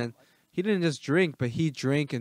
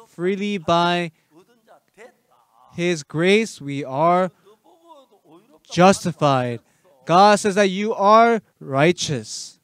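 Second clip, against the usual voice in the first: slow, drawn-out preaching, louder toward the end; slow emphatic preaching; 3 semitones higher, 5 dB louder, slower than usual. Acted. Preacher drives home grace to the congregation, slowly. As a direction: lean on each word like a pulpit climax.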